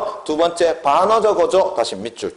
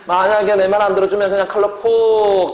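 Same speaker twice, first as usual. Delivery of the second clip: 위협적 어조; 6 semitones higher, 4 dB louder than usual. Shown as a demonstration. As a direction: threaten theatrically as a classroom demo. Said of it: "위협적 어조". (높은 톤으로 과장한 위협조 시범)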